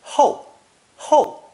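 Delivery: ho said high and loud, sharply falling; fourth tone. Shown as a demonstration. Teacher models the new character's sound for students, a high, loud falling fourth tone. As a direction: drop ho hard from the top in fourth tone. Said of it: "It's pronounced fourth tone".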